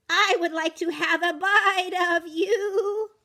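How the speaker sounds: high, loud, slow singing goat voice; sing-song goat. Acted. Goat coaxing the matzo man, singing slowly. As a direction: croon it greedily like a goat.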